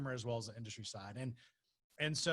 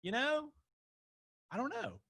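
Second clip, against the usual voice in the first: retold uncertainty; 12 semitones higher than usual. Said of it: "and I was like".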